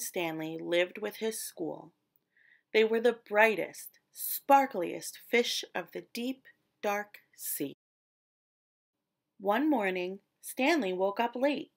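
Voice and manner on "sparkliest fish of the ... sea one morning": ordinary delivery, nothing marked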